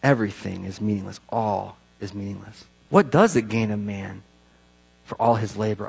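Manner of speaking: low, soft, weighty verse; solemn scripture recital. Acted. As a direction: recite the bleak finding with hushed gravity.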